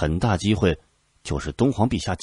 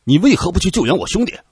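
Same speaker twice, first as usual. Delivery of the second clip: high, loud challenging cry; loud demand; 11 semitones higher, 8 dB louder than usual. A narrator's reading. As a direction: shout the question at the general. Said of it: "大声道".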